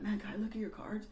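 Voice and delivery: high, soft, whispery asking; whispered request. Retold or imitated. Girl asking the kid next to her, whispered request. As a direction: imitate a child whispering a hopeful request.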